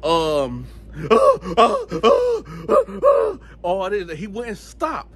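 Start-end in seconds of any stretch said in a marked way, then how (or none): 0.7-3.4 string of high gasping cries; imitated screaming fit. Cellmate who wouldn't stop, repeated high mock cries. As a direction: mimic a sleeper's full-blown tirade. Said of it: "went into a full-blown tirade".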